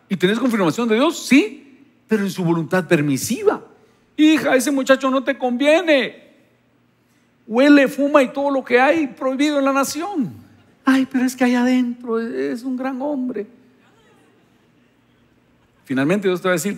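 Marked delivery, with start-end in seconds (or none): 4.2-10.3 pitched higher, a hint of a warning father; stern father warning. God warning daughter about boyfriend. 10.8-13.5 pleading daughter. Acted. Daughter defending her boyfriend.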